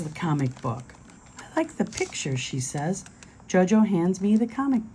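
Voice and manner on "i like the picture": put-on high, tiny toddler voice; a little girl, sweet and high. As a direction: squeak it like a small child. Little sister Jojo speaks.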